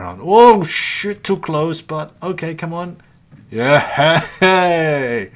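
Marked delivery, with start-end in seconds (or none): 3.5-5.3 loud laughing whoop of victory; triumphant cheer. Real, not acted. Gamer celebrating a kill after a long chase, loud laughing cheer. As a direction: let it burst out, laughing and loud. Finally destroys the enemy ship.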